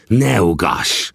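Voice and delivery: slow hissed interruption. A narrator's reading. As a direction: cut him off with a slow hiss. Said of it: "sziszegte szavamba vágva".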